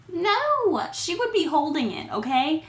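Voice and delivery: high, incredulous no, then insisting; exasperated no. Real, not acted. Vlogger arguing with the movie, exasperated. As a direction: shoot the idea down in disbelief. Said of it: "I have a hard time believing".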